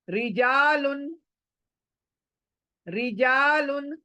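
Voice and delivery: रिजालुन slow, with a light thin R on री. Shown as a demonstration. Teacher models the thin री in रिजालुन, slowly. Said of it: "री पढ़ रही हूं ना मैं".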